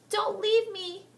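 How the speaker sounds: very high loud shriek. Acted. Heather shrieking for Helen. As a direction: scream her name into the storm. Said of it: "Heather shrieked".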